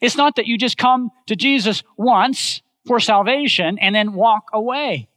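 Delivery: usual speaking voice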